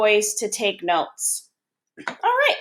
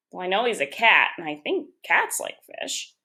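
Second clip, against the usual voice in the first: usual speaking voice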